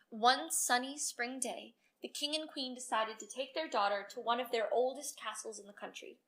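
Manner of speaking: usual speaking voice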